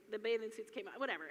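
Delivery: ordinary talk, nothing marked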